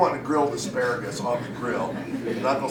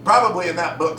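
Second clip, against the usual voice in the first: usual speaking voice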